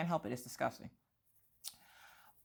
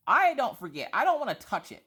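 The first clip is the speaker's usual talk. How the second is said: higher, louder, indignant; emphatic protest; 10 semitones higher, 7 dB louder than usual. Real, not acted. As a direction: insist you don't want to touch it. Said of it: "lazy sack of crap".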